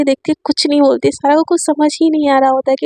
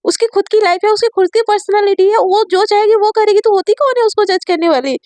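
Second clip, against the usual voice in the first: higher, heated scolding of a friend; angry outburst; 7 semitones higher than usual. Acted. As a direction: tell her off, pitch climbing. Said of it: "जिया एकदम से गुस्सा आ जाती".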